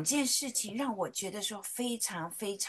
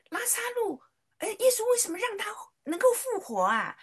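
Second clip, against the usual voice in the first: put-on much higher, curious little girl voice; child asking; 12 semitones higher, 4 dB louder than usual. Daughter asking mom in the car, high curious child voice. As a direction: ask like an eager small child.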